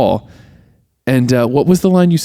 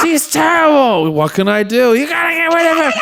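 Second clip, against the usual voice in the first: exasperated husband imitation. Acting the henpecked husband's complaint.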